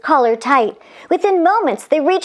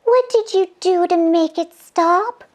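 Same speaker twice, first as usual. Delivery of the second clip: put-on lightly raised girlish voice; angel voice; 6 semitones higher than usual. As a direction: explain it with concern. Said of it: "said Angel Scarlet".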